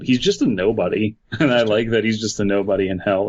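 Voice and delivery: usual speaking voice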